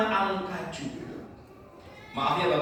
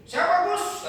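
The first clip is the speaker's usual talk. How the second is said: eager questioner; 4 dB louder, slower than usual. Acted. Listener asks Gus Dur.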